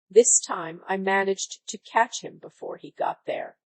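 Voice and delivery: usual speaking voice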